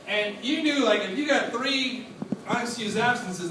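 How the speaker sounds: normal talking voice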